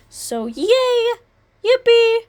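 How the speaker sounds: normal talking voice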